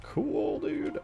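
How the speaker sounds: very high, squeaky little exclamation; delighted falsetto. Real, not acted. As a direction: coo it way up high. Reacting to his own build.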